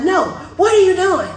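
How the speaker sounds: loud, high, exasperated demand; imitated yelling officer. Officer brushing off the license question, loud high yell. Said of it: "walk up to the window yelling at me".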